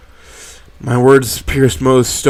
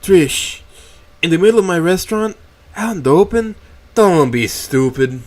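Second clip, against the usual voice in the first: exasperated; 7 semitones higher, 4 dB louder than usual. A narrator's reading. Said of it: "Mo retorts by rolling his eyes".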